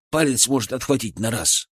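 ordinary delivery, nothing marked

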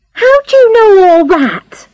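high-pitched, upset exclamation; alarmed cry. A narrator's reading. Charlotte hears her past revealed by the inspector, alarmed cry. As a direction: cry out, stung at being found out. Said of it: "cried Charlotte".

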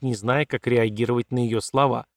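ordinary delivery

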